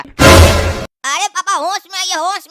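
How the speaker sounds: very loud, slow "अरे पापा होश में आइए"; loud slow yell. Acted. Son yells at father to face facts, slow loud shout. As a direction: shout it slowly to snap him out of it.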